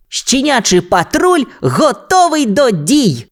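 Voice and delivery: high, slow pup reporting in; Гончик. A narrator's reading. Police pup reports to Ryder, high drawn-out announcement. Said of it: "доповів Гончик".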